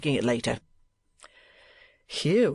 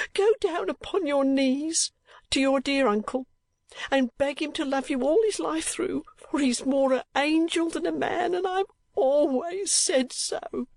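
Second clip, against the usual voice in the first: much higher, gushing mother's voice; torrent of affection; 13 semitones higher than usual. A narrator's reading. Mother urges her daughter to honour the dear uncle, gushing. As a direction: pour it out fondly. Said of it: "in a torrent of affection".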